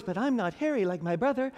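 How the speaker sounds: mock girly whine; little girly voice. Jacob pleading with his mother, little girly voice. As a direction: play Jacob as a timid little girl. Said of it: "in his little girly voice".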